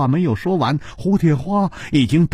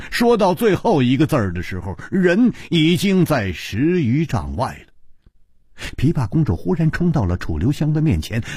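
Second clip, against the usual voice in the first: ordinary delivery, nothing marked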